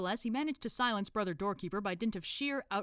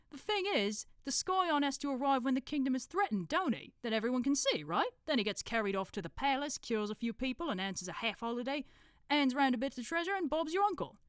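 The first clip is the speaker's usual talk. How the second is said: higher know-it-all in a put-on accent; Brother Watchtower; 8 semitones higher, 4 dB louder than usual. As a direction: explain patiently, in character.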